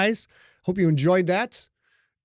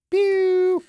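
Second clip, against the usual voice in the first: mock laser pew; 6 dB louder than usual. Sound effect after introducing A.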